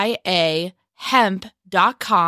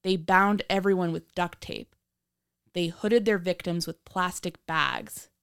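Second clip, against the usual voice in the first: true crime narrator; 10 semitones higher than usual. A narrator's reading.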